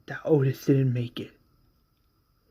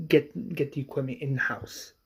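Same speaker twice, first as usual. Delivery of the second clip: plain reading voice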